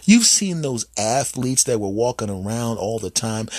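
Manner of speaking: usual speaking voice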